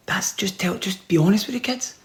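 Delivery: unvoiced conspiratorial aside; whisper. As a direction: lean in and whisper the advice.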